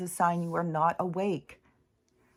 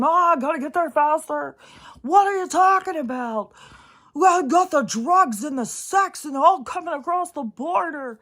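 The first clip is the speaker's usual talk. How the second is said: much higher, louder, squeaky mockery; mock commenter voice; 8 semitones higher, 7 dB louder than usual. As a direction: parody panicked commenters rushing in. Mocking rushing commenters.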